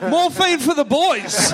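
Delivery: high, drawn-out mock bar call; hammed-up, laughing pub cheer; calling out a round. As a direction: call it to the whole ward like a drinker buying a round. Acting out a hospital happy hour.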